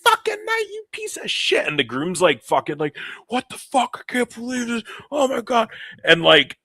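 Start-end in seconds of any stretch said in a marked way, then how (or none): 0.0-1.7 shrill-high mock screaming fury; screaming bride. Bride cursing out the man ruining her night, screaming. Acts out bride exploding at dad. 3.3-5.7 high-pitched mock distress; distraught groom. Groom watching his wedding fall apart, distraught.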